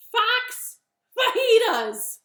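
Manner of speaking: high, loud, slow announcement; bunny's reveal. Acted. Bunny names Gruffalo's favorite food to fox, slow and loud. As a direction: stretch out the menu triumphantly. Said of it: "Bunny said".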